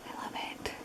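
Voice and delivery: tender whisper. Real, not acted. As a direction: whisper it fondly. Whispered ending to book praise.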